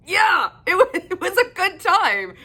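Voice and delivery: bright laughing reply, pitched high. Real, not acted. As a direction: laugh through the words. Laughing while recapping the book.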